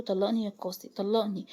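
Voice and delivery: usual speaking voice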